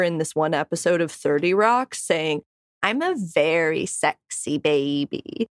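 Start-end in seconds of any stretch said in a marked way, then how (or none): 2.8-5.5 Kristen Milioti's character. Acted. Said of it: "replicate Kristen Milioti's character".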